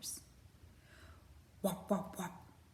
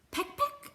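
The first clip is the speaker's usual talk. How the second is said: very high sharp peck noises; pecking sound; 4 dB louder than usual. Acted. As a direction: chirp out two quick pecks. Robin pecks nose.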